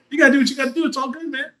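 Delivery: high, breezy reassurance to the roommate; mock chill bro. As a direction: brush it off in a high easy voice. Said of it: "I'm like".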